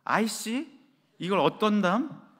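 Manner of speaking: "아이씨" snapped as a curse, slow irritated "이걸 어떤담?"; annoyed curse. Retold or imitated. How the children heard mom, as an irritated curse. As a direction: curse it out under pressure. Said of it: "그 말이 이렇게 들렸답니다".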